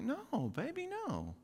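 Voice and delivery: reassuring. Retold or imitated. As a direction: soothe a frightened little girl. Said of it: "I'm like".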